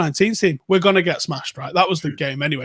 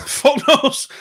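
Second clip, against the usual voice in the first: laughing shrug; 9 semitones higher, 3 dB louder than usual. Real, not acted. Repeated shrug answer, laughing.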